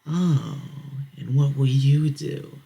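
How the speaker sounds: mock the challenger, stretching it out slowly; sneering dare; taunting bully. Bully taunts Jeff back.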